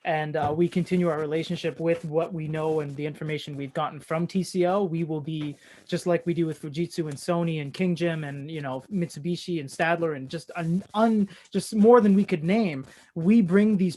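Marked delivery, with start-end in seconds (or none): none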